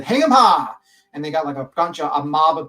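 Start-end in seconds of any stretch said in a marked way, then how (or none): 0.0-0.7 high, loud shout; loud Western rallying yell; Old West mob cry. Acted. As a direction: call it out like a lynch mob. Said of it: "the Old West where they said".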